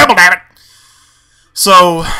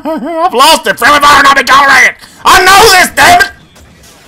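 much higher, loud, close to a wail; frustrated cry; 8 semitones higher, 4 dB louder, slower than usual. Real, not acted. Gamer losing it after friendly fire, loud frustrated wail. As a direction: let the frustration break out of you. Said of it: "I'm angry. I've lost my mind".

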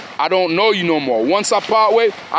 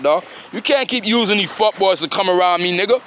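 ordinary talk

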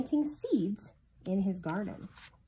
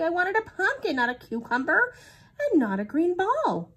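higher, louder sad complaint; higher, louder, upset whine; sad wailing; 10 semitones higher, 6 dB louder than usual. A narrator's reading. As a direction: wail your disappointment. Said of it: "said Pooh sadly".

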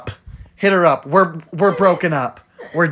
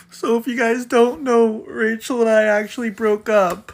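normal talking voice

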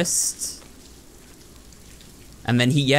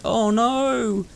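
high falsetto cry of a distraught lover; lightly voiced lover wailing oh no; mock distraught lover; 9 semitones higher than usual. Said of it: "his wife or lover was like".

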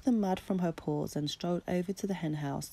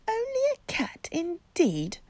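indignant mother cat; 12 semitones higher, 7 dB louder than usual. Acted. Mother retorts.

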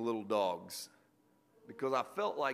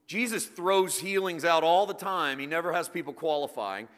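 a touch louder, building preaching; rising preaching; 3 semitones higher, 7 dB louder than usual. Acted. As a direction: build the sermon toward its point.

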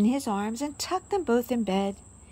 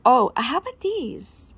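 put-on louder, eager little girl; eager question; 3 semitones higher, 8 dB louder than usual. A narrator's reading. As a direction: show off the find with excitement. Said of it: "asked Daisy".

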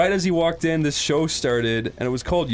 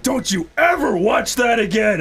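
higher, slow, emphatic scolding; pissed-off dad; 6 semitones higher, 3 dB louder than usual. Retold or imitated. Imitating dad scolding his kid over TV, angry. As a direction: lay down the law like a furious father. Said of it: "he was so fucking pissed off".

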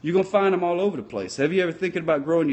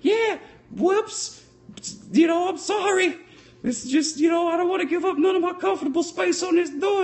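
much higher falsetto Rose brushing him off; mock Rose; 14 semitones higher than usual. Rose refusing Jack room on the door, in mock falsetto. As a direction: squeak out a selfish Rose. Rose voiced retelling Titanic.

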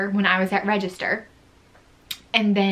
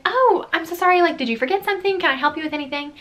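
sweet service voice; 8 semitones higher, faster than usual. Retold or imitated. Own chirpy customer service reply.

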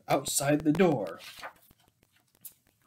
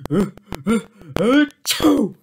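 acted sneeze; 3 semitones higher, 5 dB louder than usual. Sneeze from the dust.

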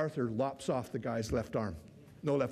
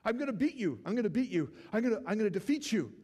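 much higher, yelping mock challenge; 9 semitones higher than usual. Preacher imitating the armless knight calling after Arthur, high mock yell. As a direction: squeal after him, refusing to give up. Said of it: "the guy's going".

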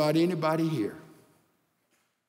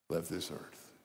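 hushed grief; 11 semitones lower, 12 dB quieter than usual. Real, not acted. Grief, granddaughter died suddenly.

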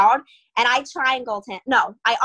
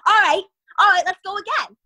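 high, loud angry demand for a rematch; pissed-off challenge; 8 semitones higher, 5 dB louder than usual. Retold or imitated. Beaten man demanding a rematch, angry and loud. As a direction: bark it like a sore loser. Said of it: "and he's like".

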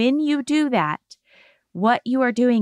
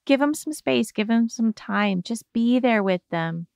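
ordinary talk, nothing marked

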